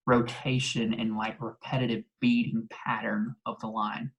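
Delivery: normal talking voice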